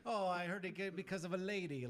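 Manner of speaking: put-on Caribbean accent, playful. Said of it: "Can you say it in, like, the Caribbean accent?".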